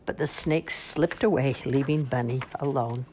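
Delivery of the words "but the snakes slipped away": usual speaking voice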